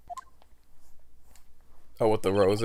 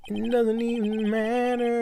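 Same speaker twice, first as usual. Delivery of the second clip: character voice; 9 semitones higher than usual. Acted. Voicing character dismissively.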